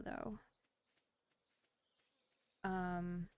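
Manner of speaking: normal talking voice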